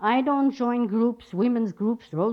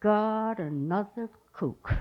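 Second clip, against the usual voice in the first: slow, low groan of disbelief; retold inward exasperation, sinking softer; dismayed aside; 3 semitones lower, 4 dB quieter, much slower than usual. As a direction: drag it out wearily under your breath. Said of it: "I'm going".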